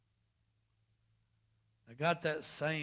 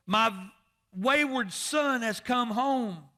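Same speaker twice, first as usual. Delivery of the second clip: loud, high preacher's proclamation, slowing at the end; triumphant preaching, slowed for emphasis; full-force preaching; 10 semitones higher, 10 dB louder than usual. Acted. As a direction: announce the father's joy to the whole church.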